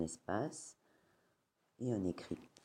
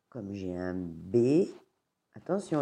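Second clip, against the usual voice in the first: usual speaking voice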